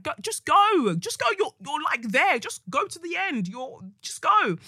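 high, loud pushing go; urging coach. Retold or imitated. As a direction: push her on like an eager swim coach. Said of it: "he was just like".

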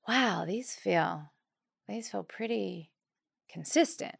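slow, soft and hushed, each phrase breaking off; hushed, awed murmur. Retold or imitated. Mother in labor realising the contractions are regular, slow and hushed. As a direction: speak softly, as if noticing something big.

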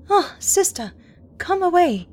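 high, loud alarmed cry; frightened cry. Acted. Anne crying out to her sister in alarm. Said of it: "she cried".

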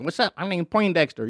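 softly voiced nerd; imitating an uncool rapper offstage. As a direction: drop the volume for a meek nerd introduction.

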